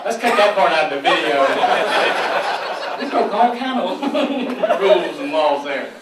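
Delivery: loud, laughing, slowing down. Real, not acted. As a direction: joke it off while laughing. Jokes about editing video.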